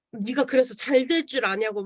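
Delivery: usual speaking voice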